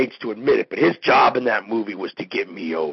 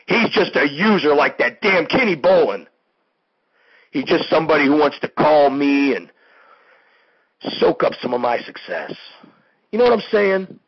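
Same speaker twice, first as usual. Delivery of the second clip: higher, louder, real indignation building; worked-up rant; 6 semitones higher, 4 dB louder than usual. Real, not acted. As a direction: get properly riled up about the user. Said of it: "didn't mean to get all worked up".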